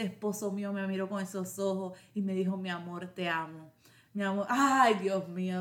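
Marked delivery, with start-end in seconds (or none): 2.6-5.6 tender. Retold or imitated. Husband's loving words after surgery.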